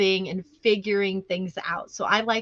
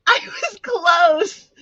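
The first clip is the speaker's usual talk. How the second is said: high, loud, stretched-out exclamation; drawn-out protest; 7 semitones higher, 6 dB louder, much slower than usual. Real, not acted. Guesser reacting to the joke's punchline, drawn-out protest. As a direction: wail it in mock protest through laughter.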